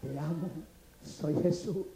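very soft, low and hushed; a quiet, tender call through glass; voice of God. Acted. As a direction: say it low and gently, with pauses. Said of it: "la voz de Dios penetraba el cristal".

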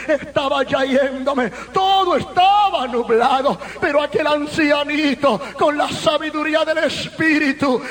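forceful testimony sermon voice; impassioned preaching. Real, not acted. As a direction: preach the story with full intensity. (predicación apasionada a toda fuerza)

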